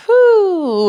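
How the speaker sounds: high loud breathy whew; effort whoop. Real, not acted. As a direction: blow out a sharp whew of effort. Instructor exhales mid-workout.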